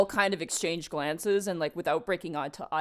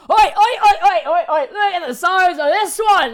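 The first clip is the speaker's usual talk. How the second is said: put-on much higher, much louder laughing drunk yell; rowdy dwarf; 12 semitones higher, 16 dB louder than usual. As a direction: hoot at the big guy from the tavern. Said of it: "one goes".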